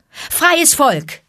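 high, ringing call to a crowd. A narrator's reading. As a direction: call the assembly to attention. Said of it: "rief er". (laut und hoch gerufen)

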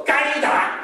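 "该打" said high and punched; firm verdict. Shown as a demonstration. Grandma told to scold the child, firm "该打". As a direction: snap "该打" out as a ruling. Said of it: "你就要说".

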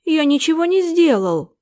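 hurt butler denying it; indignant protest. A narrator's reading. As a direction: protest your innocence, indignant. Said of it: "возмутился Дворецкий".

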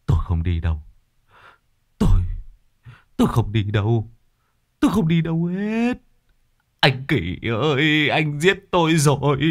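fevered, frightened mumbling that rises into a plea; delirious raving. A narrator's reading. Feverish old Lữ raving about ghost Kỷ to his wife. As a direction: rave half-conscious, begging not to be taken. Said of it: "mê man nói sảng".

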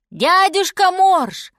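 high, slow, loud call to wake someone; mother bear hailing from afar; shouting. A narrator's reading. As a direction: call out loudly, stretched. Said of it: "крикнула медведица".

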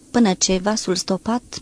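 plain reading voice

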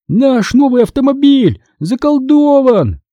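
high, slow, stretched-out cry; narrated yell. A narrator's reading. Boy desperately warns parents about haunted car, acted yell. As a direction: yell it with restrained volume. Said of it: "заорал я во всю мощь лёгких".

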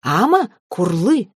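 slow creature call with swooping pitch; scolding dragon. A narrator's reading. Mother dragon spanking her child, swooping scold. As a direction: swing each word up, chiding.

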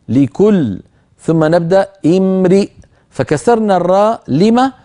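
ordinary talk, nothing marked

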